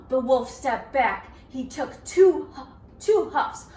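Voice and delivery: ordinary delivery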